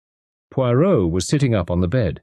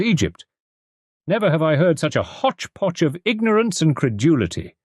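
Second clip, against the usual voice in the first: angry scorn; 10 semitones higher than usual. A narrator's reading. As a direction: pour scorn on the impostor. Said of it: "he exclaimed angrily".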